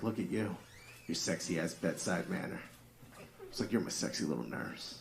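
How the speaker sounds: hushed bedroom tease; soft dirty talk. Retold or imitated. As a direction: coo it to her in bed. Dirty talking wife in bed.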